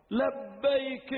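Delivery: high, long drawn-out reply. Acted. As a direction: call back with voice lifted. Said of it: "أن صوته أرفع من صوت أمه قليلا".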